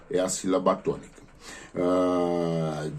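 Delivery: ordinary talk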